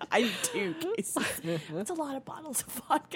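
laughing. Real, not acted. Joking about vodka cases.

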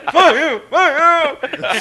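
high, loud wailing curse; hammed-up agonized yell; pained cursing. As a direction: reenact cursing a laughing friend through pain. Injured, cursing laughing friend.

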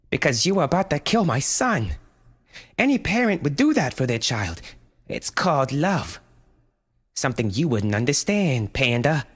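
high, firm mother voice; defiant mother. A narrator's reading. Mother defying the panda, high and firm. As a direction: hold your ground against the beast.

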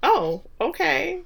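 high, slow, baffled; drawn-out stunned reaction; taken aback. Retold or imitated.

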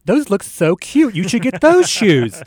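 loud, high-pitched mock gushing; gushing loved one. Host imitates a loved one advising on shoes, gushing. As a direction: coo over cute shoes, hammed-up and drawn out.

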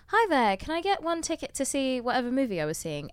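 put-on lightly done British accent at a ticket counter. Woman ordering a movie ticket in her British accent. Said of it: "my British accent".

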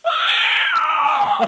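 pterodactyl shriek. Pretend. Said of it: "it's my pterodactyl sound".

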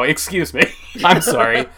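ordinary talk, nothing marked

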